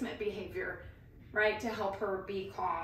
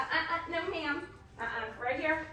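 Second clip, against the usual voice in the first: commanding a dog; 7 semitones higher than usual. Real, not acted. Correcting the dog.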